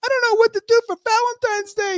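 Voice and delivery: high, fast mock confusion; confused listener voice. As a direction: voice a clueless person asking. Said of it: "So if you're out there like".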